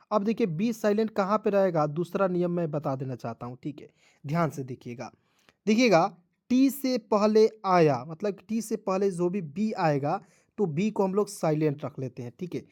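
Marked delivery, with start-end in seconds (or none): none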